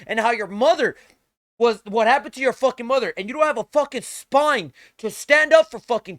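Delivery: high, loud screaming insults; enraged yelling at a streamer; furious shout. Real, not acted. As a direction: scream it right in his face. Screaming rant at Asmongold.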